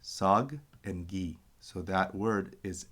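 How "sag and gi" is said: sag and gi said slowly as two separate syllables; slow syllable-by-syllable model of sag and gi; split into syllables. Shown as a demonstration. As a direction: pull sag and gi apart slowly for the class. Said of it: "we have our two syllables".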